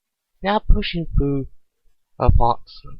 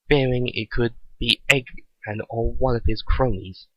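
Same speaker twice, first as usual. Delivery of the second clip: ordinary delivery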